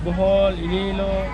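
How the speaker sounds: ordinary talk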